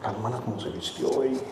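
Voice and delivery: low, hushed mock gossip; catty pew gossip, low and hushed; gossiping churchgoer. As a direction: imitate a nosy churchgoer whispering about someone's outfit. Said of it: "Otros vienen a la iglesia".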